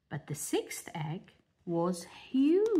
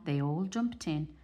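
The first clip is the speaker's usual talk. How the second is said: plain reading voice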